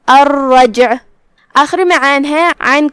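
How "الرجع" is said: الرجع with the doubled r and a bouncing echo on the silent jeem; crisp الرجع, jeem jolted; qalqalah on jeem. Shown as a demonstration. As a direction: let the jeem in الرجع rebound. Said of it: "ہم جیم کو ہلاتے ہوئے یہ کلمہ کو پڑھیں گے".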